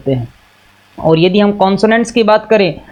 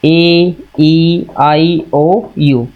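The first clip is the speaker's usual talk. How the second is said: slow letter recital; 2 semitones lower, much slower than usual. Shown as a demonstration. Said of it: "vowels जो हैं वो five हैं".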